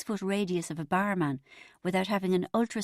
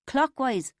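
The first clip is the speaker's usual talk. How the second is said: called out, loud and higher; calling out; 6 semitones higher, 4 dB louder than usual. A narrator's reading. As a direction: call it across the room. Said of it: "I called to him".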